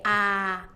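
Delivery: "Ah" held with mouth wide open; doctor's-exam ah. Shown as a demonstration. Patient asked by doctor to say it, wide-open ah. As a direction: open up as for a throat check, "Ah". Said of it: "like when the doctor".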